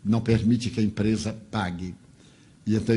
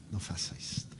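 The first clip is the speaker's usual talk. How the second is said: "não faça isto" breathed in a whisper, much softer; whispered plea; 5 semitones lower, 15 dB quieter, faster than usual. Retold or imitated. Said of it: "Eu digo". ("não faça isto" sussurrado, em súplica)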